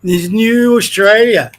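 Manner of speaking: slow, drawn-out scornful quote; contemptuous, dragged-out repeat; mocking echo. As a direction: stretch the words out to mock the phrase. Parrots the clip's phrase with scorn.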